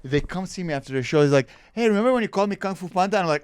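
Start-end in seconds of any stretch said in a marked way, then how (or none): 1.7-3.2 audience member. Retold or imitated. Voices boyfriend approaching after show.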